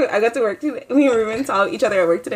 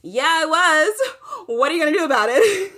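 higher, drawn-out retold comeback; defiant challenge; 5 semitones higher than usual. Retelling a comeback to someone who overheard, defiant. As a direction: throw the challenge back, daring them.

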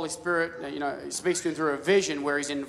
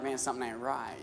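quiet unease; 4 semitones lower, 10 dB quieter than usual. Retold or imitated. Inner voice sensing wrong.

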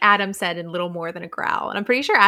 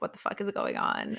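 mock whispered shock; 9 dB quieter than usual. Faculty reacting, whispered.

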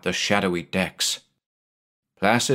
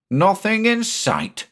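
slow, much higher disbelieving repeat; incredulous; 12 semitones higher, slower than usual. Acted. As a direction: echo it in disbelief. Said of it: "the skipper repeated".

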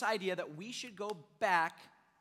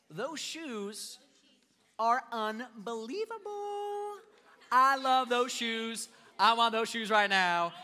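excited gushing, slow and louder at the end; gushing over shoes; 4 semitones higher, 5 dB louder, slower than usual. Retold or imitated. Shopper gushing over shoes in a store, excited. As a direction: swoon over the shoes you want. Acting out shoe-lover's excitement.

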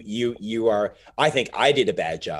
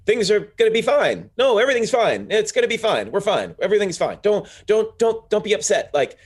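high, bright, overly soothing reassurance; mock reassuring chirp; 9 semitones higher, 3 dB louder than usual. Friend to her scared friend, falsely chirpy and soothing. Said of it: "I was sort of like".

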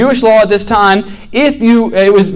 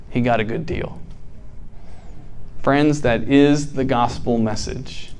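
earnest sermon; 6 semitones lower, 6 dB quieter than usual. Acted. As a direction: let the point land softly. Turns to sermon message.

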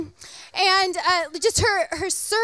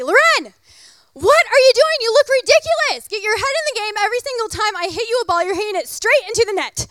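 yelling; 5 semitones higher, 6 dB louder than usual. Retold or imitated. Partner yelling across the court.

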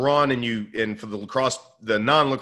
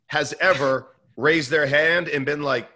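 usual speaking voice

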